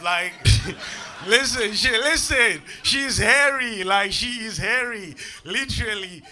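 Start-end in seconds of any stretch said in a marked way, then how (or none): none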